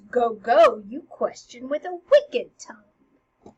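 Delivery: loud opening, slowing on the insult; pointed comeback, drawn out at the end; acted retort. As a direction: fire the scolding back at her. Hamlet's retort in acted quarrel.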